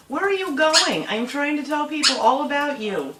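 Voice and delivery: high, loud exasperated sing-song; calling after bird. Real, not acted. Owner calling escaping parrot back, high loud exasperated. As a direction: chase it with your voice. Bird runs off mid-video.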